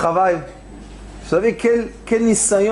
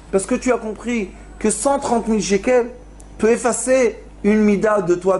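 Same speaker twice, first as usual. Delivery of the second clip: ordinary talk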